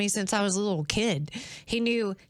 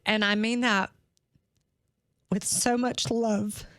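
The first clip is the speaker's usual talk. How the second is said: crying. Real, not acted. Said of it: "I didn't mean to cry on this podcast".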